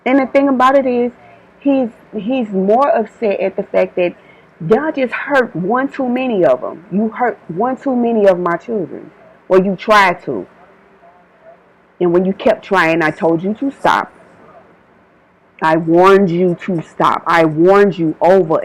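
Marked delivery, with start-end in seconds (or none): none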